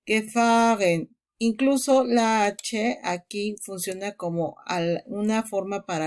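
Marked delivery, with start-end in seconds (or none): 0.0-1.2 gefahren, slow, stress on fa and a long a; German word stress. Shown as a demonstration. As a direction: lean on fa in gefahren, ge light. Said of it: "Entonces aquí dice".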